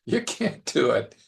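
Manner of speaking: playful, knowing doubt, rising to a chuckle; lighthearted ribbing with a high, amused lilt; teasing, with a wink. Acted. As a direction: gently kid a friend who is overpromising. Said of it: "With a twinkle in his eye, with a wink and a nod?".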